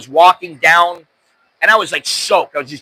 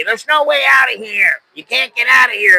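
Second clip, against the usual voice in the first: mock yelling; 7 semitones higher than usual. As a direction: imitate a yelling man, pitch jumped up. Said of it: "this guy was yelling at me".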